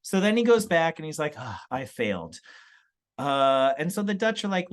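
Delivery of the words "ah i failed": low, soft sigh of defeat; mock dejection. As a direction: play a deflated failure. Explorer reporting his failure back.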